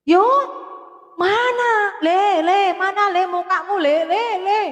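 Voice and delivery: lightly voiced grandfather calling the child sweetly; grandpa coaxing. Grandfather coaxing grandson to show face, high and sing-song.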